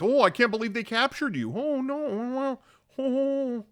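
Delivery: hammed-up, high wailing pretend shock; fake shock. Dooku visits captured Obi-Wan in his cell, badly faked shock. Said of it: "he acts shocked".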